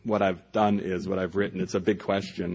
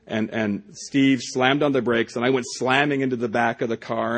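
normal talking voice

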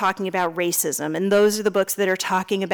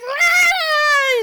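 high cartoon war cry; mother making a dress's high battle cry; mock battle cry; 3 dB louder than usual. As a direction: roar into the fight. Dresses fight.

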